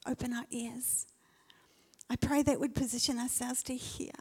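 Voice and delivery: hushed whispered prayer. Real, not acted. Pastor whispering her prayer for the church. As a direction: whisper the petition.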